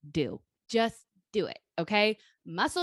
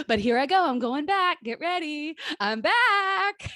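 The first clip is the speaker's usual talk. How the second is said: high and louder, building to I'm back; triumphant announcement; 6 semitones higher, 5 dB louder, slower than usual. Real, not acted. Announcing to her friend she's heading back, triumphant. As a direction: proclaim your return like a boxer.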